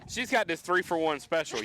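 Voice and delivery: usual speaking voice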